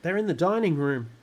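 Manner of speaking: high, loud panicky warning; urgent alarm. A narrator's reading. Mother says where the burglars are, urgent alarm. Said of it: "hissed the mother".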